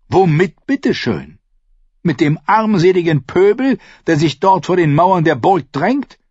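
high-voiced, ringing roll call of allies; Renly, proud and scornful. A narrator's reading. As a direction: boast of your army, pitched high and building. (hohe, stolz prahlende Stimme)

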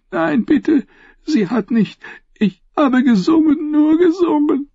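very high, sobbing denial; desperate wailing. A narrator's reading. Tortured singer denies the affair, wailing. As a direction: wail it out, begging.

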